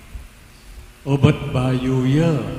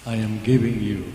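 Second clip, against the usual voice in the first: usual speaking voice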